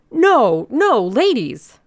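high, loud, drawn-out protest; frantic cry. A narrator's reading. Organizer leaping about to stop the ladies, crying out. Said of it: "cried Mister Thurkell, leaping about".

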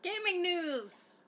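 slow, high announcer voice. Acted. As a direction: announce it like a show opener. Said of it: "I wanna do the news now".

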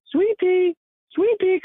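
put-on high quavery old lady calling her dog. To the narrator, old lady calls for her dog in a high voice.

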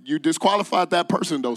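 ordinary talk, nothing marked

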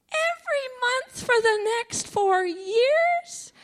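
high, slow, stunned disbelief; stunned question. Retold or imitated. Hearing about monthly payments, high stunned disbelief. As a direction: ask it like you can't believe the number. Shocked at the insurance payout.

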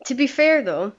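words round a bite of ice cream; chewing while talking. Real, not acted. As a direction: mouth full, talking anyway. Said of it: "if you can hear me eating ice cream".

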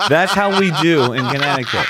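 said through a loud laugh. Real, not acted. Repeats line, first laughing.

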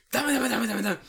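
loud, high repeated telling-off; mock angry scolding. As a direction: imitate an outraged older man scolding. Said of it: "He's so mad at me".

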